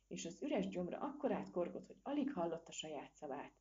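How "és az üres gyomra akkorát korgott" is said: ordinary delivery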